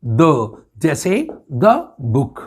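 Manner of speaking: slow, short "द" with a schwa; "द" clipped before a consonant; the said as thuh. Shown as a demonstration. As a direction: say "द book" slowly, no long ee. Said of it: "तो हम इसे बोलेंगे द".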